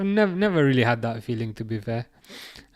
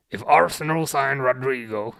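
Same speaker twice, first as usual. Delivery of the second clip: put-on failed Arthur Morgan accent. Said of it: "I can't do an Arthur accent".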